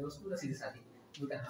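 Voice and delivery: ordinary talk, nothing marked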